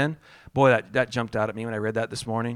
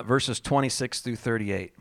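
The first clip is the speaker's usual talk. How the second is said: normal talking voice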